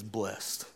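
blessed said as "blest"; "blessed" with a silent e, ending in -st; one-syllable blessed. Shown as a demonstration. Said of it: "no marks that make it weird".

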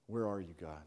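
low, hushed questioning; quiet plea to God. Acted. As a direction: ask it softly, almost to yourself. Acted doubt in the wilderness.

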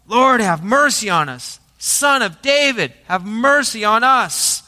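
insistent, drawn-out cry for mercy. Acted. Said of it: "they cried out all the more".

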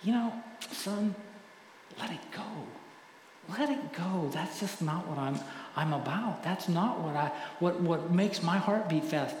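like a father soothing his son; God gently tells a son to let it go; tender voice of God. Pretend. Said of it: "tap us on the shoulder and say".

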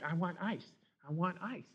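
put-on soft, whiny little girl begging; whining child. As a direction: plead like a small kid nagging for ice. Four-year-old demanding ice.

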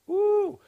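high excited woo; excited whoop. Real, not acted.

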